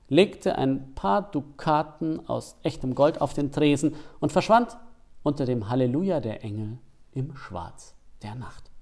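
usual speaking voice

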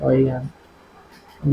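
low, very slow muttered aside; plotting revenge against his sister-in-law, a slow low mutter; scheming mutter. Acted. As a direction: mutter it to yourself, slowly. Plotting alone after the quarrel.

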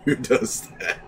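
high, laughing disbelief; real laughing. Real, not acted. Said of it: "I'm laughing about it bad now".

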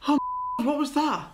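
sudden high startled cry. Real, not acted. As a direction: jump at the noise. Startled by a noise.

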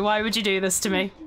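ordinary talk

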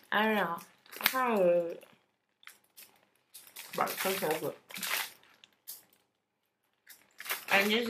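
talking while eating cake; muffled speech between bites; mouth full, chewing. Real, not acted. Seen 3: bites chocolate cake at 0.3, chews with full mouth throughout.

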